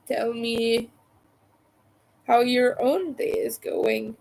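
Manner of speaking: ordinary talk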